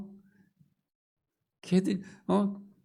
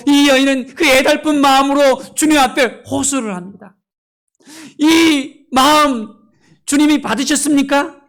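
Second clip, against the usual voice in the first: much higher and louder fervent preaching climax; rising pulpit plea, higher and louder; impassioned preaching; 8 semitones higher, 9 dB louder than usual. Real, not acted. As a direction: cry it out to the congregation at full force.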